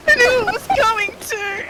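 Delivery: high-pitched laughing through the words; cracking up, can barely get it out; giggling fit mid-sentence. Real, not acted. Giggling after botched line.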